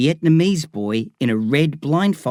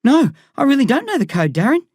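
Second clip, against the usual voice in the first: fast, higher, pleading; nervous protest; 12 semitones higher, 4 dB louder, faster than usual. A narrator's reading. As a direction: talk fast to wriggle out. Narrator protesting quickly.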